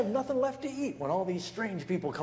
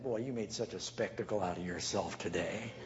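lower, softer, scolding; disapproving wife; 7 semitones lower, 6 dB quieter than usual. Retold or imitated. Wife scolding her husband at the door, low disapproval. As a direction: cut him down quietly.

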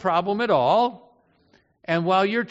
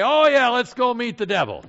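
loud, higher, sarcastically gung-ho; put-on enthusiasm, pitched up; mock eagerness; 6 semitones higher, 7 dB louder than usual. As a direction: act out eager readiness you don't really have. Mocks eagerness pastors don't have.

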